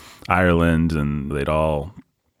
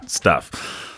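ordinary delivery

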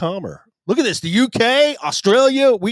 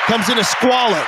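hyped shout; 6 semitones higher than usual. Real, not acted. Hyping squirrel pockets.

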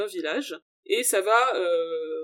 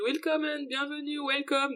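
put-on a light German cabaret-singer accent on welcome, a touch higher and softer; 4 semitones higher, 4 dB quieter than usual. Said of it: "avec un accent, donc là c'est un accent allemand". (la chanteuse accueille le visiteur, léger accent allemand)

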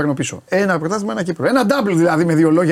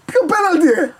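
high-pitched mock disbelief; retold incredulous question; 13 semitones higher than usual. Fan answering his friend at the match, retold incredulity. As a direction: challenge it as if amazed, pitch jumping up.

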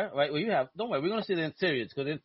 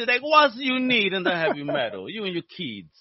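higher-pitched mock Italian accent; bad Italian accent; 3 semitones higher than usual. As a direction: read it with a hammy, broken Italian accent. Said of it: "trying to do a bad Italian accent".

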